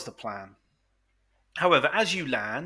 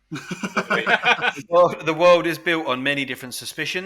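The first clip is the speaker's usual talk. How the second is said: laughing while speaking; 3 semitones higher, 8 dB louder than usual. Real, not acted. Laughing through a mock courtroom reply.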